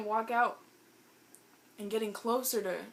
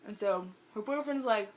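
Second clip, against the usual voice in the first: ordinary delivery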